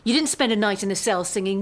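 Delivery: usual speaking voice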